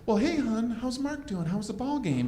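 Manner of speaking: wife leaning over in bed, quietly questioning her husband; sleepy wife. Retold or imitated. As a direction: ask sweetly, suspicious under it. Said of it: "she's like".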